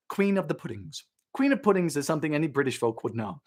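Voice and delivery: normal talking voice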